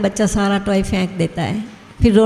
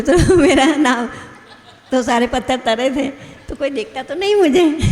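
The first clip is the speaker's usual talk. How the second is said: higher voice breaking into giggles; laughing retelling; 5 semitones higher than usual. Retold or imitated. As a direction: act out the furtive worry while laughing. Tells funny story of Ram, amused. (हँसते हुए, ऊँची आवाज़ में)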